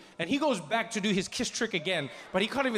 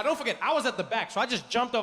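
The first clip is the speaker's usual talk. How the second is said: ordinary talk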